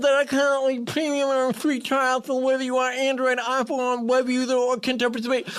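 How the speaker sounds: talking with tongue pinched; clothespin on tongue. Real, not acted. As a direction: speak with your tongue clamped. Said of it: "clothespin on my tongue".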